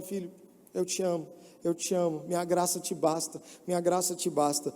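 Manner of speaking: soft, gentle voice of the Father; tender reply. Acted. The Lord answering the struggling son, tender and soft. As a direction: soothe him like a loving father.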